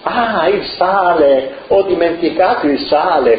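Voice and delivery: light up as it hits you; sudden realization. A narrator's reading. The cook mother suddenly remembers the salt, surprised.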